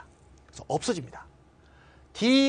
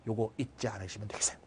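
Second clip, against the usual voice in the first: whisper; 9 semitones lower, 12 dB quieter, faster than usual. Real, not acted. Aside to students.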